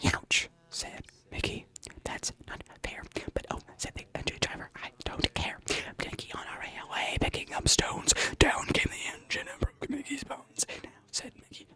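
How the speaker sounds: sussurro sem voz, arrastado; whisper. Pretend. Whispered story rhyme.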